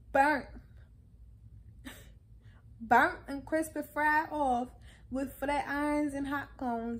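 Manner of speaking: loud, with a laugh; loud emphatic. Real, not acted. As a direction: hammer the words burnt and fried.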